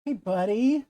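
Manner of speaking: high pet voice; talking to a dog. Real, not acted. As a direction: coo at the dog.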